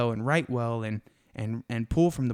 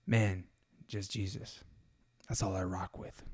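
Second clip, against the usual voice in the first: lower, softer, laid-back "just Jesus"; low and hushed; 4 semitones lower, 8 dB quieter than usual. Shown as a demonstration. Showing listeners a third way, low and hushed. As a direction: murmur it low like a quiet confession. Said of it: "three amazing ways".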